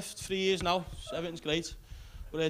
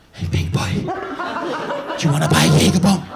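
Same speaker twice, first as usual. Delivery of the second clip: much louder breathy camp flirt; sultry camp come-on; 11 dB louder than usual. Retold or imitated. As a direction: lean in and seduce him loudly. Seller gets close.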